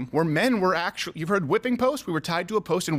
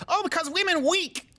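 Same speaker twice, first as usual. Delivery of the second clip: exaggerated mock Chinese accent; 11 semitones higher than usual. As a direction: put on a crude Chinese accent pitched up high. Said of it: "a racist stereotypical accent".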